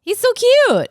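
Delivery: high, loud swooning gush. Real, not acted. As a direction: squeal it like a fangirl. Gushing over [name].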